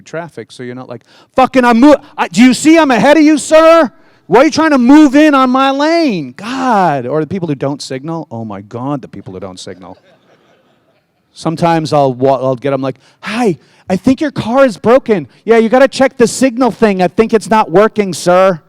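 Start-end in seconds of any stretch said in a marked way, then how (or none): 1.3-7.1 much higher, louder exasperated yelling; mock road rage. Driver yelling at someone stealing his lane. 13.2-18.6 higher, sugary sarcastic politeness; sweetly mock the driver who won't signal; fake polite. Telling a non-signalling driver his car is broken.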